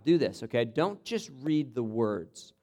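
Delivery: ordinary talk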